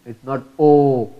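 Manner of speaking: ordinary talk, nothing marked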